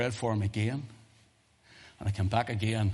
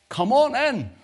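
high, loud holler from indoors; shouted invitation; 9 dB louder, slower than usual. Retold or imitated. Householder shouting to the preacher outside, high loud call. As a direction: imitate an impatient call through the door. Voice inside calls out once more.

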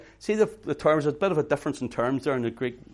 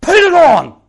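huge, much higher yelled order; commanding shout; 19 dB louder, slower than usual. Acted. Paul ordering believers to arm themselves, a roaring shout. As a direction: roar the command at full voice. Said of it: "That's what it reads".

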